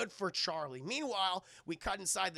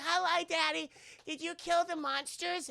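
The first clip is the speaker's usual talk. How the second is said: high, lightly voiced little girl; a hint of a little girl; little daughter; 10 semitones higher, 4 dB louder than usual. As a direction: sound like a small child. Daughter greets her dad.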